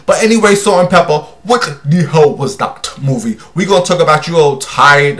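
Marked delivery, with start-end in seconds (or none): 1.4-3.4 put-on African accent. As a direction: drop into a mock African accent. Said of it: "In my African voice".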